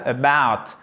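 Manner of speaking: about, leaning on BOUT; unstressed schwa first, then a strong BOUT in about; stress on second syllable. Shown as a demonstration. Teacher demonstrates English word stress, about stressed on BOUT. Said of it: "Accentuation sur la deuxième syllabe".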